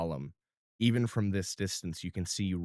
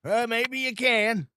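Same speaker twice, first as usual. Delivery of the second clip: much higher, slower squeaky voice; squeaky Gus; 5 dB louder, slower than usual. Acted. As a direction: squeak it out nervously.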